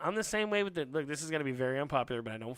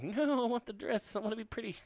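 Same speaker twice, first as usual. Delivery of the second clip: put-on pleading child; 6 semitones higher than usual. Boy refusing overalls, high child voice. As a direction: put on a high little-kid plea.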